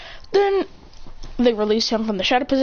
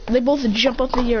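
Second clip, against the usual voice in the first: normal talking voice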